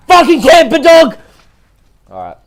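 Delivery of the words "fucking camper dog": high, full-volume rage scream; furious scream. Real, not acted. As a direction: scream it at the camper. Screams at camper.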